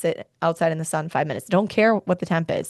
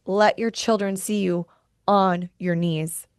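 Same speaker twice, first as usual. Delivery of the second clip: ordinary talk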